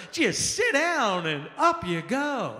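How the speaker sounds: very slow, soothing commercial read; mock stair-lift commercial, very slow. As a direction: drag it out like a calm TV ad.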